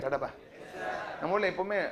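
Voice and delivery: quick Tamil aside through a laugh; tossed off with a chuckle, fast; சிரித்தபடி வேகமாக. Real, not acted. Teacher laughs at local habit.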